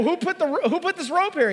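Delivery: high, panicky voice asking who put the rope there; alarmed disbelief, voice jumping up high; panicky question. Retold or imitated. To his climbing friend, retelling his panic about the old rope, high and alarmed.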